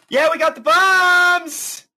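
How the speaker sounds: high, triumphant yell; excited shout. Acted. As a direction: crow it out proudly. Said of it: "and she goes".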